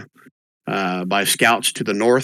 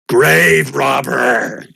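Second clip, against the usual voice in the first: slow, louder ghostly wail; haunting voice; 3 semitones higher, 5 dB louder, much slower than usual. Acted. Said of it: "a ghostly voice".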